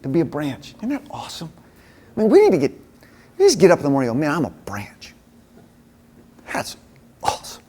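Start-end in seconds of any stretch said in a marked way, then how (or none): 4.2-7.6 hushed whisper, as if to yourself; a believer waking up, murmuring to himself in a whisper; whispered self-talk. Pretend. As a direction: whisper it like a private realization. Imagined morning self-talk.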